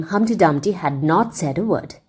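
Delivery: ordinary delivery, nothing marked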